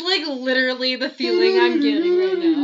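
loud, high, spooked cry; creeped out. Real, not acted. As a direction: voice jumps up in real fright. Creeped out talking about Mothman.

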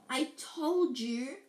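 slow, drawn-out gasped reproach. Acted. Hermione to friends, clutching a stitch, gasped. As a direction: stretch it out as if winded. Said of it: "Hermione gasped".

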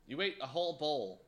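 usual speaking voice

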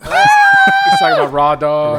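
loud, slow, high shout. Real, not acted. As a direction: yell it out slowly. Shouting the joke out.

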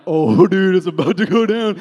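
loud, excited "oh dude" reaction; hyped onlooker. Retold or imitated. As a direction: retell it like a thrilled fight spectator. Retold excitement watching a fight.

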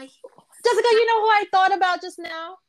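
loud, high burst of sudden recall; excitedly cutting in, loud; excited outburst. Real, not acted. Suddenly remembers something, bursts in.

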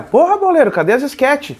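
high, pushy fan complaint; demanding fan voice. Retold or imitated. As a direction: whine it like an impatient fan. Fans demanding sketches.

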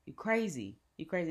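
mild mock exasperation, stammered start; imitated mom. Mom about to hang up, lightly imitated exasperation. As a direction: lightly imitate a mom giving up on her child. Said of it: "And she was like".

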